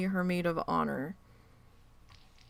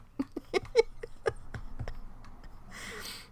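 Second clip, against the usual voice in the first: breathy laugh; 14 semitones higher, 5 dB quieter than usual. Real, not acted. Laughing at own suggestion.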